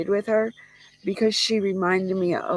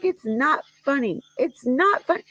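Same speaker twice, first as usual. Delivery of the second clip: much higher, mildly miffed grandmother insisting; high, half-serious huff; mock indignant protest; 8 semitones higher than usual. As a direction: imitate her huffy denial. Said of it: "she was getting mad".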